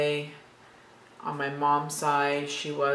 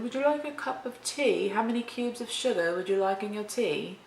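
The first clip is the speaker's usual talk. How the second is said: higher-pitched mock British tea-party voice; British accent; 6 semitones higher than usual. Shown as a demonstration. Offering tea and sugar to show off, British accent. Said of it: "I do a very great British accent".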